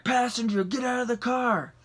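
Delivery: mock police shout, pitched high; cop going off. Cop ordering passenger out of car, mock shout. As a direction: imitate an officer calling out an order. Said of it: "like, just going off".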